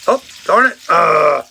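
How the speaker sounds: loud, high surprised yelp; sudden exclamation. Real, not acted. The selfie stick pops off, he yelps in surprise.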